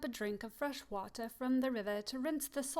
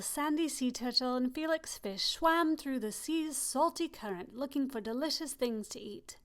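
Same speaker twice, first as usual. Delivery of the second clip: ordinary delivery, nothing marked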